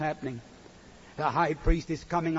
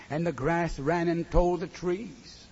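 ordinary talk, nothing marked

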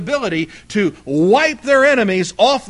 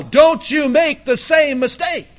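higher, pleading warning cry; screaming from hell; 8 semitones higher, 4 dB louder than usual. Acted. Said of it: "screaming from hell".

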